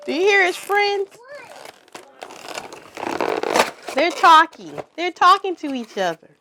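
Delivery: loud, high, quick excitement; thrilled, showing off the toy; excited. Real, not acted.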